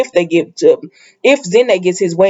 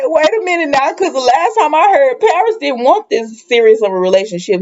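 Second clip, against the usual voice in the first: skeptical Quinn; 7 semitones higher than usual. Retold or imitated. Voicing Quinn's doubt.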